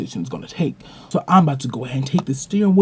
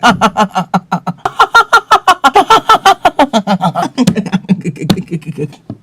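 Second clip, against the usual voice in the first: loud, high hammed-up cackling laugh; mock girls' giggle; 7 semitones higher, 10 dB louder than usual. Girls laughing at his joke, loud high mock giggle. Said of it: "These girls are like".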